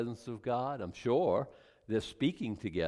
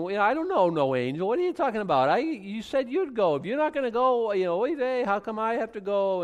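indignant Moses; 9 semitones higher, 5 dB louder than usual. Retold or imitated. Moses arguing with God.

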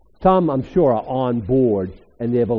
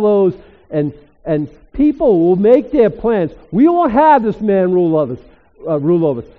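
loud, higher, rising proclamation; full-force preaching; 6 semitones higher, 9 dB louder than usual. Acted. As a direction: lift the room, declare it with power.

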